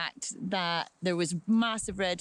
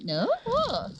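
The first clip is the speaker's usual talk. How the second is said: retold curious surprise. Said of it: "And I'm like".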